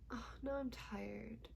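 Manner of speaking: ordinary talk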